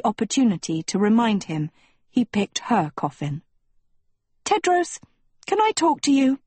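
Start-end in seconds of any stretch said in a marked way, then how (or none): 4.4-6.4 put-on high, urgent girlish appeal; blurted. A narrator's reading. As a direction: blurt it out, pitched well up. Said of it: "Sophie blurted finally".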